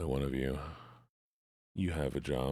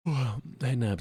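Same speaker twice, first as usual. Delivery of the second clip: excited greeting; 9 semitones higher, 4 dB louder than usual. Real, not acted. Greeting the chat happily.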